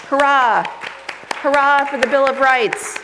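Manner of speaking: loud, high hammed-up hurrah; teacher cheering the Bill of Rights, hammed-up loud; mock cheer.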